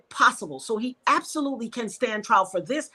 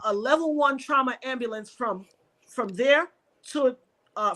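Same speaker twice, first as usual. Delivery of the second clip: ordinary talk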